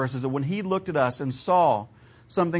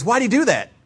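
put-on higher, louder curious little boy; son's question; 6 semitones higher, 4 dB louder than usual. Pastor voicing his son's question about the dragon, higher and louder. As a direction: ask it like a puzzled kid. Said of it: "And he goes".

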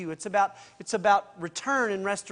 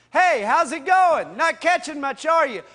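heckling; 8 semitones higher, 10 dB louder than usual. Retold or imitated. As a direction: heckle the fishermen from the shore. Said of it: "like, heckling them".

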